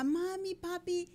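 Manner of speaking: put-on high-pitched pleading child; mock tearful kid voice, high; imitated crying little boy. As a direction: draw it out like a little boy begging his parents. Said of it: "un niñito que lloraba y decía".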